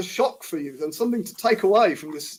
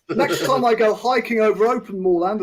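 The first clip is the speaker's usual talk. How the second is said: ordinary talk, nothing marked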